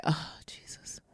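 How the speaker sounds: whispered, exasperated aside; hushed voiceless sigh of a prayer; imitating worried mom. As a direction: breathe it under your breath. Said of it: "I could feel the woman in the background".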